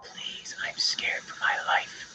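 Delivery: hushed hostage plea, half joking; mock whisper. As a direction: whisper it as if someone is listening. Mock plea while producer mouths.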